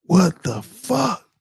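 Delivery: slow, drawn-out stunned what the fuck; stunned disbelief. Real, not acted. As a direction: let it sink in, each word stretched out. Stunned reaction to horror story.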